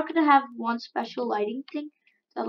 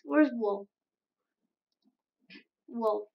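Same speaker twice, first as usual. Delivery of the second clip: normal talking voice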